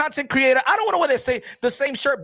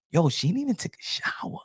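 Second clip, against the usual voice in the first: whisper; 6 semitones lower, 5 dB quieter than usual. Retold or imitated. Retold thought about a streamer.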